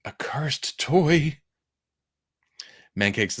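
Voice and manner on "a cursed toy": drawn-out, eerie whisper; slow creepy stage whisper; spooky whisper. Acted. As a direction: whisper it slowly like a ghostly warning.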